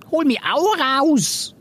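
high, singsong chameleon pipe; fluting plea. A narrator's reading. Chameleon Silly wanting out too, high fluting voice. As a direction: flute it high, drawing out the words. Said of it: "flötete Silly".

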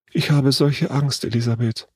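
lightly voiced scared girl, not truly whispered; frightened murmur. A narrator's reading. Lene confides in the older girl under fire, frightened. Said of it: "flüsterte Lene".

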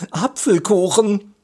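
high-pitched, relishing exclamation; delighted. A narrator's reading. Boy recognises the apple cake by smell, delighted. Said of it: "schnupperte entzückt".

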